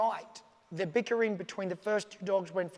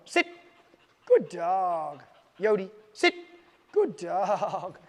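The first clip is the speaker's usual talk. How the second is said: louder cheerful sit and praise; upbeat praise tone; 4 dB louder, much slower than usual. Shown as a demonstration. Trainer demonstrates lifted tone for commands, cheerful. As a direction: reward the dog with your voice. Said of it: "That's the tone I want".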